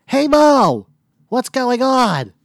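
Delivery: high, loud stooge impression; Larry Fine. Showing a friend a comic's peculiar voice, high loud impression. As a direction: holler it out high and drawn out. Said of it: "if you can do Larry Fine".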